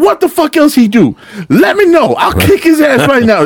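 high-pitched, riled-up indignation; hyped up. Retold or imitated. Worker siding with an angry customer, hyped up. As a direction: act outraged on the caller's behalf. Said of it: "I'd get hyped with them".

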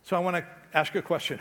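ordinary delivery

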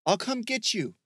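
high, carrying call. Acted. Michael heading to rescue Bear, calling out. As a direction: call it across a distance. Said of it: "Michael called out".

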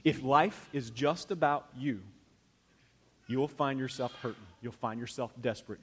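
ordinary talk, nothing marked

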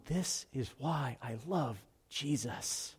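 slow, soft and choked up, as if close to tears; tearful retelling. Retold or imitated. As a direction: relive the moment you broke down, very slowly. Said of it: "I just broke down in tears".

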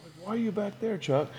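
mock puzzled sideline question; hushed puzzled call. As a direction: ask a teammate quietly why he's lurking behind you. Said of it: "and I'd be like".

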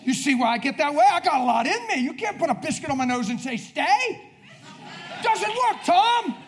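high, loud protest; playful loud outburst. Real, not acted. Preacher teasing Tom about waiting, playful shouting. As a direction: burst out at a friend who held you back.

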